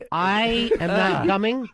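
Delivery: slow, spaced-out refusal; emphatic refusal. Real, not acted. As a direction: spell it out slowly, word by word. Emphatic refusal before listing reasons.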